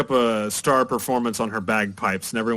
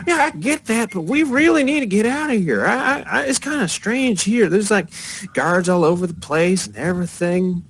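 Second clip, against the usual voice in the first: put-on much higher, louder urgent girl; tiefling girl; 10 semitones higher, 3 dB louder than usual. Tiefling girl uneasy about the guards, high and urgent. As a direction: push the pitch way up and plead to leave. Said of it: "between the tiefling girl".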